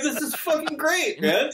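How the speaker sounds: high-pitched giddy delight; laughing mock exultation; elated. Elated at having supplies.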